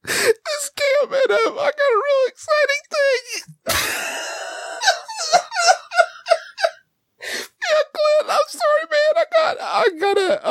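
very high, squeezed by suppressed laughing; helpless laughter. Real, not acted. Prank caller pitching a ham, cracking up in character. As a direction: fight the giggles and keep talking.

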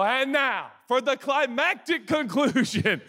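slow, dramatic, laughing through it; mock grand announcement. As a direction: pastor covering his slip to the crowd, slow and laughing. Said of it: "And I go".

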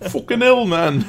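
mock Northern accent, high and loud; hammed-up Scottish-sounding curse; bad accent impression. Teasing Niels with a botched accent. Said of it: "That was a terrible impression of your accent".

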